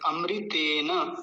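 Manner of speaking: अमृतेन slowly, long ते and a whole na; full न. Shown as a demonstration. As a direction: draw out अमृतेन, keeping the vowel on न. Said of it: "न पूरा है". (अमृतेन में न पूरा, धीरे)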